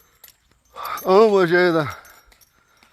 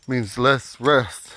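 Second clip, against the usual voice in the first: normal talking voice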